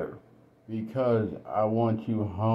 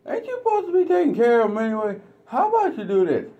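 mocking husband; 13 semitones higher, 3 dB louder, faster than usual. Voice jumps in retold quarrel.

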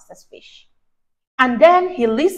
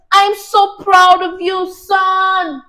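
higher, louder beaming father; proud dad; 7 semitones higher, 6 dB louder, slower than usual. Acted. Dad cheering his son after the race, beaming. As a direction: beam with pride like a dad. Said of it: "He said".

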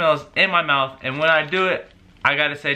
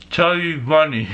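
cheeks stuffed full. Real, not acted. Mouth stuffed with marshmallows.

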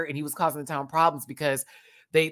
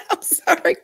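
high giggle through "I'm sorry"; laughing apology; 4 dB louder than usual. Real, not acted. Laughing at a super chat.

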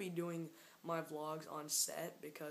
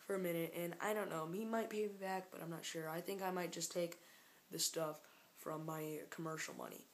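ordinary delivery

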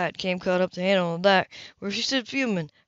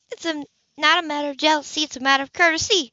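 higher, clipped-off denial in a woman's voice; indignant Hannah; 7 semitones higher, faster than usual. Acted. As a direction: insist it's about courtesy, bristling. Hannah denies jealousy.

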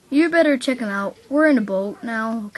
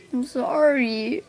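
ordinary talk, nothing marked

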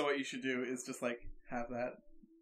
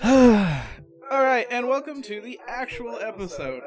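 podcast host intro; 10 semitones higher, 18 dB louder, slower than usual. Acted. Loud show intro after sigh.

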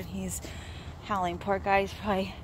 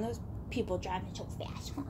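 whispering; 4 semitones lower, 9 dB quieter than usual. Real, not acted. Whispered aside.

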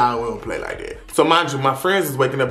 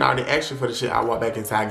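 ordinary talk

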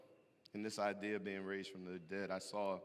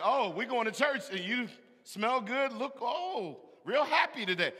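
high, loud teasing of the wife's cheer; sing-song needling of an excited wife; mocking wife; 11 semitones higher, 8 dB louder than usual. Husband teasing his excited wife about church, high and loud. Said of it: "I'm like".

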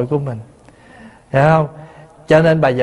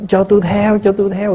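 mock wailing mourner; 5 semitones higher than usual. Monk imitating mourners at a funeral, mock wailing. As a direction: imitate a mourner crying out to follow the dead. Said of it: "gào, gào, gào vậy đó".

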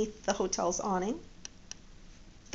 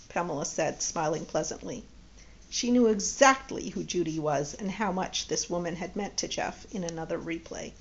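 ordinary delivery, nothing marked

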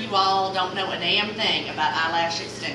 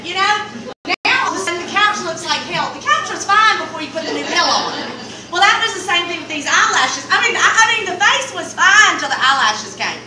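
higher, louder comic rant about the couch; 8 semitones higher, 8 dB louder than usual. Real, not acted. Storyteller to audience, loud higher comic outrage. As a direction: ramp it up, mock outrage.

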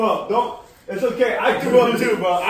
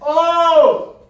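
pained shout; 7 semitones higher, 7 dB louder than usual. Real, not acted. Mouth burning.

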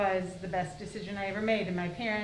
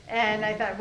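ordinary talk

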